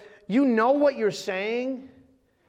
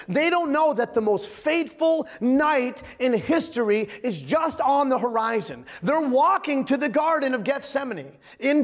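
emphatic, higher-pitched sermon delivery; preaching; 5 semitones higher than usual. Real, not acted. Pastor relaying Jesus's warning to his disciples, urgent preaching. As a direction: drive each phrase home to the congregation. Preaches after the comic story.

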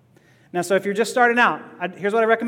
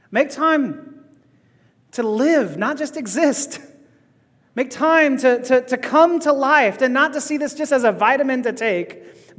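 higher, lifted preaching voice; impassioned preaching; 4 semitones higher than usual. Real, not acted. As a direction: preach it with rising urgency, pitched up. Preacher's closing exhortation.

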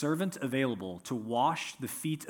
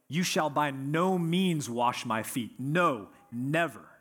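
slow, weighty "You shall by no means wash my feet. No, never."; preacher hammering home Peter's double negative, slowly; emphatic; slower than usual. Retold or imitated. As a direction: press every word of the refusal. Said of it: "He's that emphatic about it".

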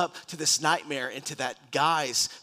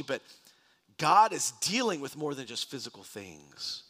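ordinary talk, nothing marked

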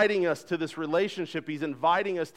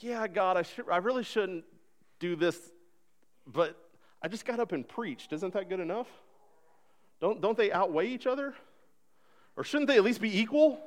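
higher, pleading self-justifying whine; bargaining with God; 7 semitones higher than usual. Retold or imitated. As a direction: talk your way out of it with God. Preacher acts his bargaining with God.

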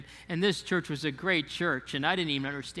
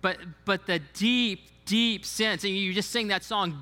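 ordinary talk, nothing marked

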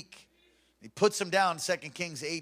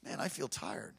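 tired sigh; 9 semitones lower, 10 dB quieter than usual. Acted. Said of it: "And then you're like".

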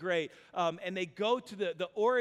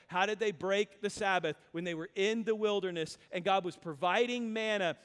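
normal talking voice

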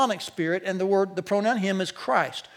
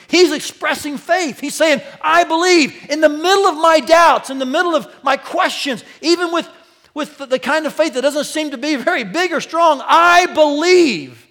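loud, high, full-force sermon delivery; impassioned preaching; 7 semitones higher, 10 dB louder than usual. Real, not acted.

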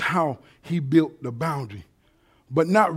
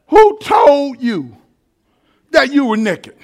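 higher, louder and slow, booming it out; thundering question; 8 semitones higher, 8 dB louder, slower than usual. Acted. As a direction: make the whole church hear it. Same question repeated, thundered.

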